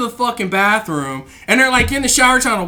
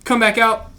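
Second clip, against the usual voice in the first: ordinary talk, nothing marked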